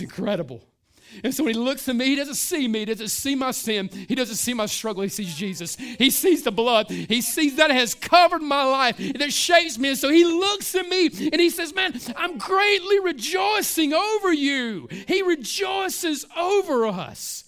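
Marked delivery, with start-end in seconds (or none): 5.8-17.4 full-force preaching. Acted. Preacher shouting to his congregation about God's joy. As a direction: proclaim it to the back row.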